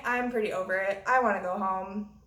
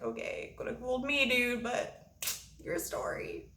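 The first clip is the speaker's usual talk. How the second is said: muttered aside; 6 dB quieter than usual. Retold or imitated. Muttering sarcastically to her drunk friend about Becky. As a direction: mutter it so he can't hear.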